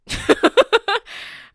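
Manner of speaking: high, loud burst of laughter; incredulous laugh. Real, not acted. Loud laugh at absurd story.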